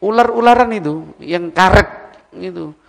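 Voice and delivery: ordinary delivery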